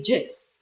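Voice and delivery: "yes" said as "jess"; hard j at the start of "yes"; mispronunciation demo. Shown as a demonstration. Lecturer forbidding an error, "yes" with j. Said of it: "Don't ever say".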